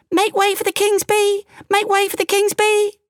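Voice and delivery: very high-pitched little bee voice; tiny piping voice. A narrator's reading. Tiny bee announces itself to the king, high and piping. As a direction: pipe up like a tiny creature. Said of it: "a tiny voice piped".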